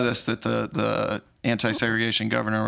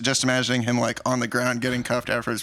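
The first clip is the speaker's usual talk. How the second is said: usual speaking voice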